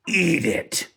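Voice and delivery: mock an enraged drill sergeant's order. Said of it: "and he goes".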